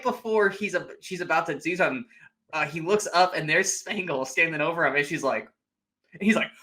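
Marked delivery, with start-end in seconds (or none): none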